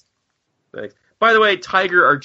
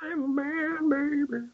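much higher, softer put-on voice; mock macho quip; 10 dB quieter, slower than usual. Cohost quipping after the man talk, high and soft. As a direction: toss off a silly swagger line. Austin Powers-ish quip.

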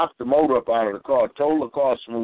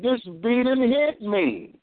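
high, slow, wounded outburst; retold anger; 8 semitones higher, slower than usual. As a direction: drag it out, still stung by the blow. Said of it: "I said".

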